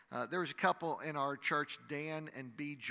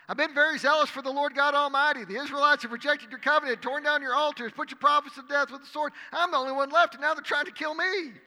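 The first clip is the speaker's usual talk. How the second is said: loud, much higher desperate complaint; anguished outcry; 11 semitones higher, 11 dB louder, faster than usual. Acted. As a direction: pour out despair at full voice. Elijah repeats his complaint.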